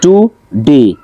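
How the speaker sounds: টুডে split as টু, ডে; two separate beats on টুডে; syllable by syllable. Shown as a demonstration. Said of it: "এইভাবে দেওয়া আছে".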